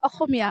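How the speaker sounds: "Akhomia" with kh and rounded o; Assamese pronunciation. Shown as a demonstration. Answering what language she speaks, Assamese pronunciation. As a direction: say "Akhomia" the native way, ending in -ia. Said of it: "what language do you speak?".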